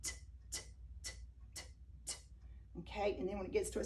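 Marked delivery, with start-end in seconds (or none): 0.0-2.2 crisp repeated "t" like a ticking clock; soft mock ticking; ticking timer. Shown as a demonstration. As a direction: tick it out like the timer going round. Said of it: "you hear it go".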